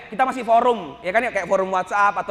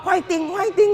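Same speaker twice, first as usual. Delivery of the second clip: wannabe Korean cheer; 9 semitones higher, slower than usual. Retold or imitated. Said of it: "Pengen banget ketahuan bisa bahasa Korea".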